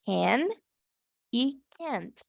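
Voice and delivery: "can" with E, "can't" ending on T; can versus can't. Shown as a demonstration. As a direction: contrast "can" and "can't" by the final T. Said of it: "Así sería como se pronuncia".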